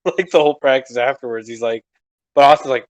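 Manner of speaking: normal talking voice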